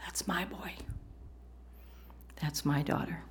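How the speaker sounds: soft, hushed and fond; proud, tender father. Acted. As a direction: murmur it like a proud dad beaming at his kid. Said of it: "He'll look and he says".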